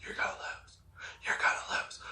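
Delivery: a soft breathy taunt, near silent; hushed, teasing threat repeated in his ear; taunting whisper. Retold or imitated. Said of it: "is just whispering".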